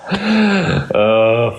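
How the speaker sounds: bursts out laughing, high, then sighs; real laugh. Real, not acted. Cracking up at his co-host's joke, laughing hard. Joke about a lovemaking session.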